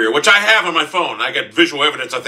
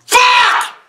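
high, loud burst of swearing; explosive high yell; furious shout; 6 dB louder than usual. Real, not acted. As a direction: scream the curse as you lose. Curse after losing.

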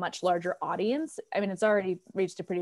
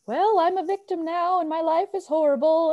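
high, loud mock self-pity; mock the self-pitying complainer; whiny victim; 8 semitones higher, 4 dB louder than usual. Friend mimics people who give up, in mock self-pity. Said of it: "they just kind of go".

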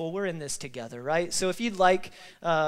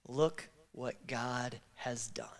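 choked whisper; 5 semitones lower, 7 dB quieter, much slower than usual. Shown as a demonstration. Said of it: "can we just give a resounding".

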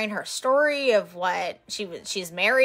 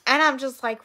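normal talking voice

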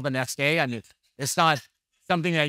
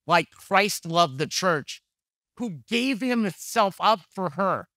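slow, deliberate reading of the verse; slow scripture reading; 2 semitones higher, slower than usual. Shown as a demonstration. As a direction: let each word of scripture land slowly. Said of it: "slow scripture reading".

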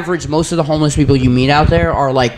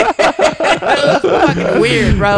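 laughing; 10 semitones higher, much slower than usual. Real, not acted. Laughing while retelling weird encounter.

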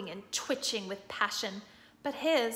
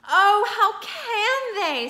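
higher, louder, slow tearful cry; tearful Lucy; 10 semitones higher, 7 dB louder, slower than usual. A narrator's reading. Lucy crying at the crowd's cruelty, higher and slow. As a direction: break down at the cruelty. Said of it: "tears streaming down her cheeks".

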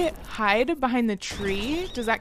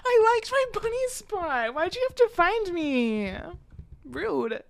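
whiny cry; 7 semitones higher than usual. Real, not acted. Lost hiding spot.

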